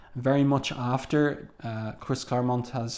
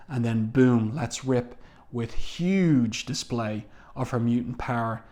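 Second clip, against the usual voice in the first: normal talking voice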